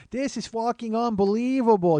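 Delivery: put-on high, drawn-out angry New York accent; mock an irate New Yorker, stretching the words. Woman griping on her phone, angry New York accent. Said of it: "I can't do a New York accent, uh, unless it's an angry one".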